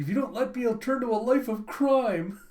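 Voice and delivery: high, loud melodramatic ultimatum; mock threat. Child warning parents she will turn bad, mock threat. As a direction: overplay a child's dire threat.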